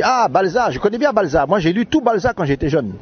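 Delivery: high-pitched mock African accent. Sketch boss delighted to recognise balsa, high African accent. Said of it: "avec l'accent africain comme ça".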